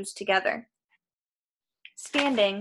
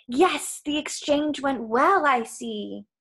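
lightly voiced evil monster, starting high and settling; a hint of cold menace; 5 semitones higher, slower than usual. A narrator's reading. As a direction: start raised, then settle into understated evil. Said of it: "cold and evil, echoing from the depths".

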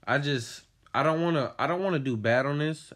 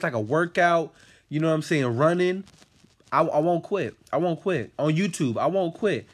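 louder, higher determined vow; fired up; 6 semitones higher, 5 dB louder than usual. Real, not acted. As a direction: insist loudly you won't quit. Vows he won't quit, loud.

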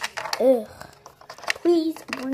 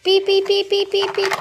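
higher, louder mimicked ring; phone beeping; 6 semitones higher, 5 dB louder than usual. Kid mimicking the phone ringing again, high and loud.

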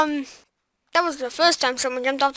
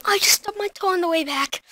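ordinary talk

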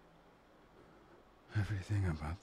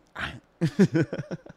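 teasing laugh; 6 semitones higher, 17 dB louder than usual. Acted. Gleeful laugh after biting.

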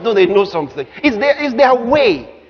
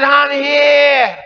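higher, held-out reply; Adam answering God; 4 semitones higher than usual. Acted. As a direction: call back from a hiding place. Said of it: "He said".

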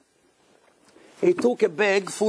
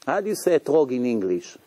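usual speaking voice

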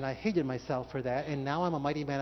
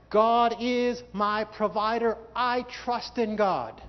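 louder, slow and emphatic; shouted confession; 6 semitones higher, 7 dB louder, much slower than usual. Shown as a demonstration. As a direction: proclaim it slowly at full voice. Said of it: "Shout it out if you know".

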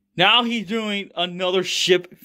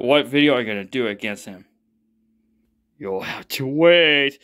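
ordinary talk

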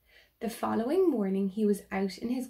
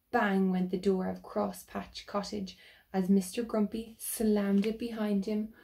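plain reading voice

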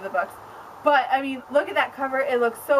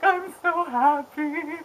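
teary happy; 3 semitones higher, slower than usual. Retold or imitated. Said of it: "I almost started to cry".

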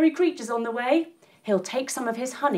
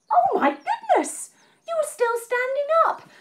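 squealing, much higher cry of wonder; amazed; 14 semitones higher than usual. Acted. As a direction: gush with delight at the child. Amazed the child still stands.

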